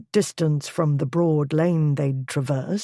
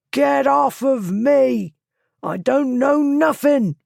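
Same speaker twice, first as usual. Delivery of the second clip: high, loud desperate yelling; thief yelling; 9 semitones higher, 4 dB louder, slower than usual. A narrator's reading. Thief yelling at Blackwood while shaken, high and loud. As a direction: yell and deny everything in a panic.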